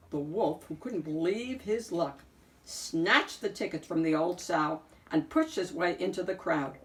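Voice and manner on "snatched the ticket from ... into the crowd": plain reading voice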